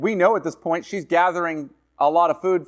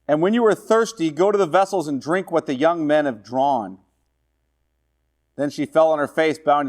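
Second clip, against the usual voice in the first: scripture reading; 2 semitones higher than usual. Acted. Preacher reading Ruth aloud to the class. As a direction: read the verse out to the class.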